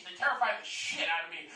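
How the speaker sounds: usual speaking voice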